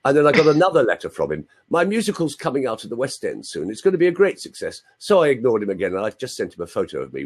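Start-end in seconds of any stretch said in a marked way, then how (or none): 1.7-4.8 higher-pitched posh English schoolboy; mock-posh English accent, pitch lifted; terribly posh. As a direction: imitate an eager posh choirboy. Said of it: "he was terribly posh".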